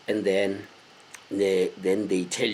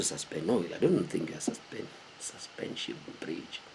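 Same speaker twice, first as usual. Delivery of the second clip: whispered aside; under his breath; 5 semitones lower, 13 dB quieter than usual. Real, not acted. Checking a word, he whispers to himself. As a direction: whisper it to yourself.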